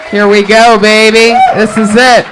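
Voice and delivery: loud, high hype-man introduction; show announcer. Real, not acted. Host opens the live show and introduces guests, rousing announcer. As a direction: bring on the guests at full volume.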